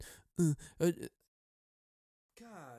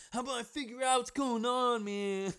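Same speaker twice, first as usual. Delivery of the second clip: mock voice; 8 semitones higher, 4 dB quieter than usual. Higher second take.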